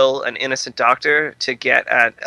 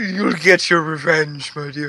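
Toulon. Acted. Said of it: "your impression of Toulon".

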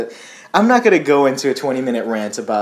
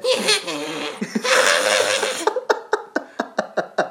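high breathy villain cackle; a wheezing heckling cackle; evil laugh; 14 semitones higher than usual. Pretend. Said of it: "how about this one?".

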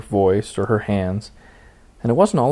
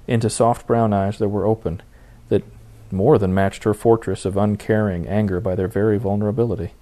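usual speaking voice

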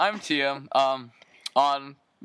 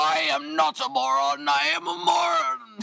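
mock shout; 10 semitones higher than usual. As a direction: yell it absurdly high.

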